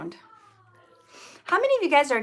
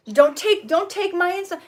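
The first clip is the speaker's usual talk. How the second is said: put-on higher, pleading like a clinging child; mock clinging plea; 7 semitones higher than usual. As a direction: clutch the bags, mock desperate. Acting someone clutching bags.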